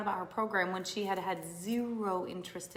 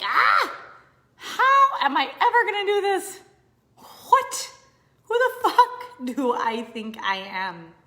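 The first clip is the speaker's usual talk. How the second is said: high, loud, near-crying mock panic; worked-up panic; 8 semitones higher, 11 dB louder, slower than usual. Acting out a worked-up woman doubting herself, high mock wail. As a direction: wail it like you're spiraling. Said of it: "have you ever gotten worked up like".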